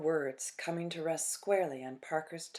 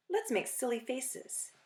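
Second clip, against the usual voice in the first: put-on playful child; 7 semitones higher than usual.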